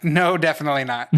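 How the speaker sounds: a firm no that breaks into a laugh; laughing rejection. Retold or imitated. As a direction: turn down a player's bad voice attempt, laughing. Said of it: "And other times I'm like".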